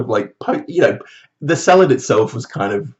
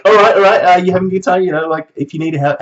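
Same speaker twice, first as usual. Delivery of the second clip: much higher and louder, over-eager sales chirp; pushy saleswoman; 8 semitones higher, 7 dB louder than usual. Retold or imitated. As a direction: pounce on them with fake cheer. Mimics pushy saleswoman pouncing.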